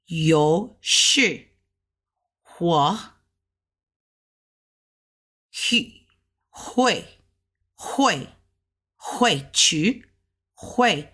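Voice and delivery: hui rising like a second tone, repeated; Mandarin phrase. Shown as a demonstration. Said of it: "how we would say it would be".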